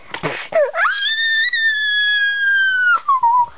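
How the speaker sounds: long, very high squealing scream; pretend scream. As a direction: shriek on and on as if jabbed. Patient screams at the shot.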